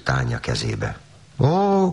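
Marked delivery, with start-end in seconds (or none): 1.4-1.9 put-on high, sudden little-girl "Ó"; surprised exclamation. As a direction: gasp it out in a child's surprise. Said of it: "kiáltott fel Tánya". (magas, meglepett felkiáltás)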